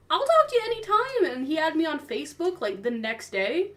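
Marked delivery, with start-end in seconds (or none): 0.1-1.2 eager promise. Retold or imitated. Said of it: "and I'm like".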